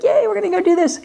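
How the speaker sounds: put-on high, thrilled little kid cheering; excited kid. Parent imitating a thrilled child promised a trip. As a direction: squeal it like a delighted child. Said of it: "They're all excited".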